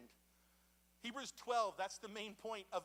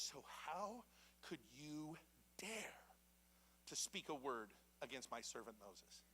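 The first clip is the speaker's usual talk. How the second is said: God asking slowly and softly, lower; slow, soft reproach; 5 semitones lower, 8 dB quieter than usual. Retold or imitated. God rebuking Aaron and Miriam, slow and low. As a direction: let each word hang, quiet and grave.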